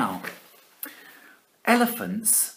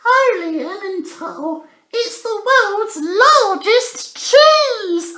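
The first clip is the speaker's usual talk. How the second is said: very high, very slow, thrilled squeal; ecstatic mouse; 9 dB louder than usual. Acted. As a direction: gasp with delight at the cheese. Mister Swiss spots cheese.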